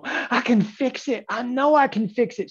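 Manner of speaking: high, drawn-out mock protest; overconfident trader. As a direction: mimic a trader insisting they can fix it, high-pitched.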